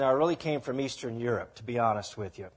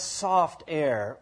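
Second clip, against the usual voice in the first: normal talking voice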